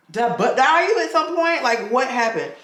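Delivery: high, incredulous question; can't believe what she's hearing; stunned disbelief. Retold or imitated. Asks her friend if she butt-dialed her, high and shocked.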